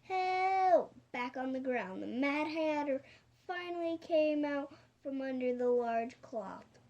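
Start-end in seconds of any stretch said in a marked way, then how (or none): 0.0-0.9 high, loud cry for help; fearful shout. A narrator's reading. Henchmen dangling from the crane, shouting in fear. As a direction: shout it out long and high in alarm. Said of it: "they shouted in fear".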